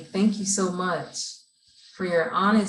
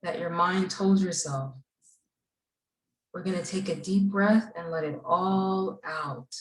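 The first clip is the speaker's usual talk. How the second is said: guided breathing. Acted. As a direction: lead the class gently through each breath. Leads class breathing exercise.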